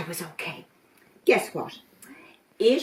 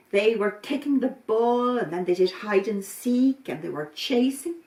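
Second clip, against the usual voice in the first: ordinary delivery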